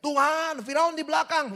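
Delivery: high, loud cry to God; panicked shout. Acted. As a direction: yell for help as Moses with Pharaoh behind. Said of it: "Musa teriak".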